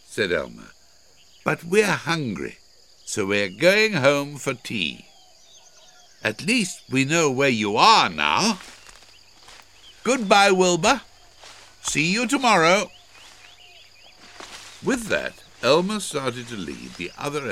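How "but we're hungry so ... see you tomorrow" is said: high, raised, carrying voice; lively high-pitched goodbye; calling a teasing farewell. A narrator's reading. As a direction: call it up breezily to someone above.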